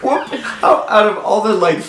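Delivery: high, laughing disbelief; can't believe what you're hearing; high laughing disbelief at the dad; incredulous disbelief. Real, not acted.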